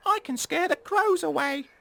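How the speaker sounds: very high, tiny mouse voice. Acted. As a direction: squeak it like a little mouse. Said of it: "said the mouse".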